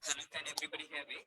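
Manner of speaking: faint, far-off mic voice; almost lost under the call line; barely audible. Real, not acted. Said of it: "Slightly louder".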